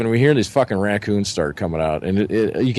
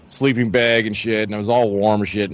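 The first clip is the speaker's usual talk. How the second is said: ordinary delivery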